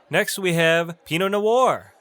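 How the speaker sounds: usual speaking voice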